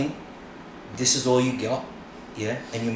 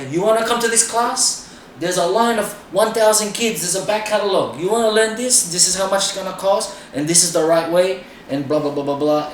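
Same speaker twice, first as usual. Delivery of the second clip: much higher, louder hard-sell teacher voice; pushy teacher; 8 semitones higher, 6 dB louder than usual. Retold or imitated. Asshole dance teacher pitching a class to students, loud and high.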